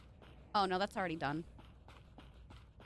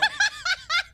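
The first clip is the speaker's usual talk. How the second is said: burst of laughter; 8 dB louder than usual. Real, not acted. Laughing at dad voice.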